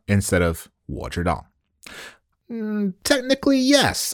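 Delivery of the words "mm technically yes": very high, reluctant concession; high-pitched grudging yes; hedging. Acted. Hedged answer to own question.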